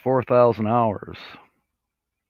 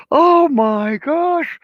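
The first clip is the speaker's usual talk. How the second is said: very high, slow, drawn-out gasp of delight; starstruck squeal; 4 dB louder, much slower than usual. Real, not acted. Vlogger squealing as famous RVers join the chat. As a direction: squeal it out, thrilled and amazed.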